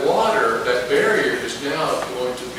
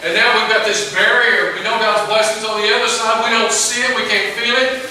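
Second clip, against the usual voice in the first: higher, louder complaining whine; whiny griping; 6 semitones higher, 4 dB louder than usual. Retold or imitated. Israelites griping to Moses at the sea, in a high whiny complaint. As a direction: gripe and moan like the trapped Israelites. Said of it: "they're griping".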